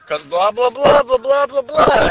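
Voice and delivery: mock rambling. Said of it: "And then you went".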